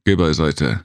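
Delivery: plain reading voice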